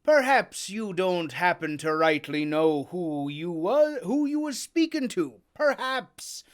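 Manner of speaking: loud, mocking, rising sailor; Silver berating. Silver badgers Morgan, loud and mocking. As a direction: needle him into talking.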